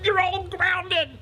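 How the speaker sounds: very high, loud, drawn-out furious shriek; screamed sentence, slow and strained; shouting. Acted. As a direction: explode like a parent at breaking point. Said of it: "He just shouts at them".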